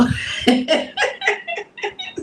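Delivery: high giggle. Real, not acted. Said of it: "See, and it's cute".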